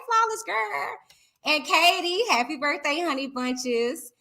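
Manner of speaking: ordinary talk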